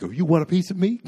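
loud, high-pitched hammed-up challenge; mock fighting challenge. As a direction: act like you're banging on the bar for a fight. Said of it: "banging on the bar like".

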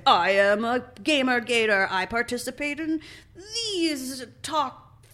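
slow theatrical villain menace. Acted. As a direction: drawl it like a cartoon villain. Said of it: "they didn't talk in a villain voice".

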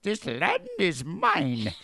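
slow, high, hammed-up Irish accent; mock a film star's awful Irish accent; terrible Irish accent. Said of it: "The worst Irish accent you've ever heard".

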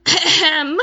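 loud, high "ahem" for attention; pointed throat-clearing. Retold or imitated. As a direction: clear the throat loudly to cut in. Pointedly interrupts to get noticed.

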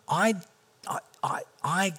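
slow, fading to a whispered groan; helpless prayer. Retold or imitated. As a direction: give up mid-prayer, voice dying away. Desperate prayer of confusion.

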